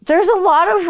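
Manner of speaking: ordinary talk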